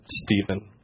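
hushed, low-pitched name; Ella's quiet interjection. Retold or imitated. Woman stops a rambling leader, soft and low. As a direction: say the name softly to halt someone. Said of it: "Just cocked her head and goes".

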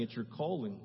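ordinary talk

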